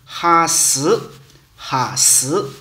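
用温州话把“哈死”说出来，“哈”重读; 温州话“哈死”，就是喝水; 温州话发音. Shown as a demonstration. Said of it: "喝水就是". (温州话念“哈死”)

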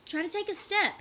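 high, loud urging. A narrator's reading. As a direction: push her to move. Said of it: "he urged".

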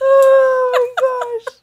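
slow, high laughing exclamation. Real, not acted. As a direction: stretch it out laughing. Still laughing.

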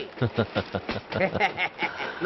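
low, drawn-out chuckle; laughing. Acted. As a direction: laugh at a friend's tale of woe. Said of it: "నవ్వు, నవ్వుతావు ఎందుకు నవ్వు?".

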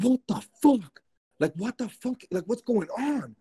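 high-pitched, squeaky freak-out; retold panic. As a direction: relive the panic, voice shooting way up. Retelling panic, heart pounding.

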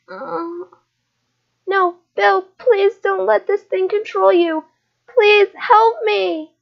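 high, pleading, slowing on help me; desperate terrified plea. Acted. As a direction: beg your brother to fight it back. Child begs possessed brother.

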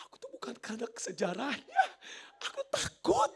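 mock crying, leaping high at the end; imitated tearful confession. Wife admits to husband why she cried, mock tearful wail. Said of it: "Ustadanya nangis".

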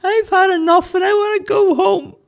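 very high, loud whining squeak; loud high cartoon whine; shrieking Charlie. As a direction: wail it like a scared little creature. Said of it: "uttered some things between deep breathing and shrieking".

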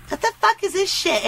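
high-pitched indignant complaint. Acted. Rejecting a breakfast taco, high indignant protest.

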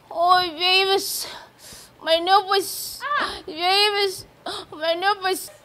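mock cries, high and drawn out; imitated shouting. Maid mimics madam's shouting for Oga, drawn-out mock cries. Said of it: "Madam was shouting".

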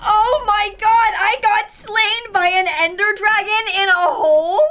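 wailing, incredulous outcry; loud disbelief. Real, not acted. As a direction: cry out, outraged at an absurd death. Just killed by the dragon.

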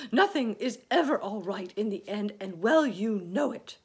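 loud, high gloomy grumbling; exasperated earthworm protest; indignant cry. Acted. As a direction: cry out your pessimism at full voice. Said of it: "cried the earthworm".

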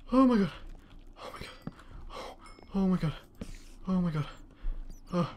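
hushed, frightened murmurs; scared and quiet. Real, not acted. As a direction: barely breathe the words out. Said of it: "I'm sorry if I was really quiet right there, but I was actually scared".

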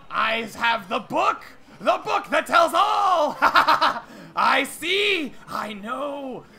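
high-pitched mad proclamation with a cackle; raving prophet. Acted. Prophet boasting of the book that tells all, raving. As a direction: reveal it with fevered conviction. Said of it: "you know, raving".

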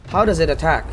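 normal talking voice

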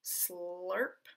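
a woman slurps from her dipper; slurping sip. A narrator's reading. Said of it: "she looked around and took a sip".

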